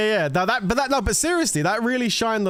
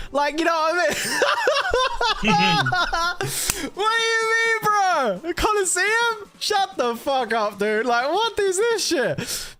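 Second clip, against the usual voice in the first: much higher, drawn-out jeering voice of a scoffing gamer; mock incredulous bro; slower than usual. Streamer mocks a viewer scoffing at the healer, high jeering voice. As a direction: ham up a disbelieving dude scoffing at the pitch.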